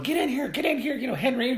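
high, loud nagging call; very high-pitched yell to come inside; imitated wife calling. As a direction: mimic a wife summoning her husband. Wife calling her husband inside.

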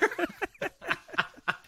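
high-pitched giggling laugh. Real, not acted. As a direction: break into laughter. Laugh at made-up words.

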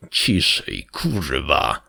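low, hushed hiss; tense, low-pitched order to be quiet; hiss through teeth. A narrator's reading. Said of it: "syknął przez zęby".

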